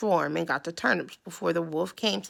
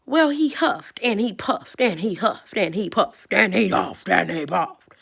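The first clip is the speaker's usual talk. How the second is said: louder, pushing each huff; read-aloud huffing and puffing with effort; 7 dB louder than usual. A narrator's reading. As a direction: build the effort of blowing. Wolf straining at brick house.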